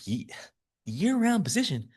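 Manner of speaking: high, astonished mock outrage; incredulous disbelief. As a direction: squeak out the shock at a year-round job. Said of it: "I was like".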